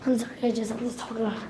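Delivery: low, soft near-whisper; very quiet, low voice; hushed talk. Real, not acted. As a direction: murmur an apology under your breath. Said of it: "we're just whispering".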